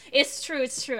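ordinary delivery

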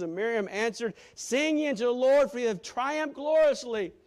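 loud, high fervent preaching; preaching at full force. Real, not acted. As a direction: preach it out with fire.

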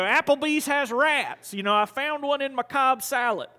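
lightly acted troublemaker's protest; mock complaint. Retold rant of thrown-out dad.

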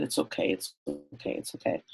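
hushed near-whisper of reassurance; soft comfort. Retold or imitated. Father softly soothing his crying son, hushed near-whisper. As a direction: fade the voice down to a breath.

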